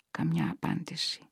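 plain reading voice

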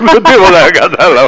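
amused, laugh breaking into the words; laughing punchline. Real, not acted. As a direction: land the punchline through laughter. Laughing at his own punchline.

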